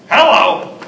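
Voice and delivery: "Hello" barked out loud and sharp. Shown as a demonstration. Showing how tone changes hello, loud and harsh. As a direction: hit the hello hard. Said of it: "all the ways you can say hello".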